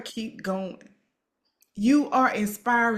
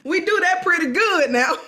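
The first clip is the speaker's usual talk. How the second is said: bursting into laughter mid-line, higher and louder; laughing; 9 semitones higher, 7 dB louder than usual. Real, not acted. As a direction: laugh right through the words. Shared laugh about boyfriend.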